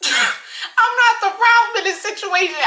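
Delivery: high, loud, drawn-out squeal of disbelief; loud protest. Retold or imitated. Worker sent to the office by manager, protesting loudly.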